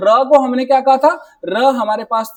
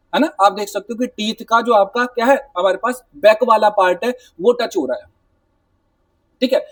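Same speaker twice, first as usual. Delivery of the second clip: ordinary talk, nothing marked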